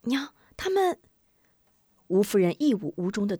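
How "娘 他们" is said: high, breathless urgency; anxious boy. Acted. Boy points out soldiers to mother, high anxious voice. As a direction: blurt it out in a high child voice. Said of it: "吴忠着急地".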